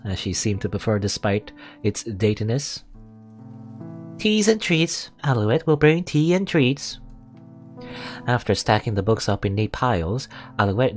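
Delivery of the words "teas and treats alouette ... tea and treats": cheerful maid voice. Acted. Maid character announcing tea.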